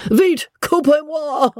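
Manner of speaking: high, urgent French shout; frantic shout. A narrator's reading. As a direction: shout it high at the servant in panic. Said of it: "shouted he".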